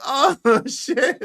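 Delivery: high cackling laughter through the words; laughing. Real, not acted. Host laughing at his own blunder. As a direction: crack up at yourself.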